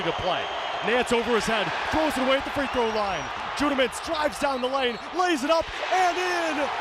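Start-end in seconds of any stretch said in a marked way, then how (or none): 1.9-6.7 excited call. Real, not acted. Announcer calling the layup, voice climbing with excitement. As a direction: call the drive with building excitement.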